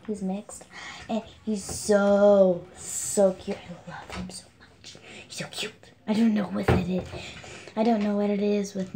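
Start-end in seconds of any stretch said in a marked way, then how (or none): 3.6-5.7 breathy secret whisper about a crush; hushed gushing whisper; whispered confession. Real, not acted.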